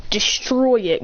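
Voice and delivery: ordinary delivery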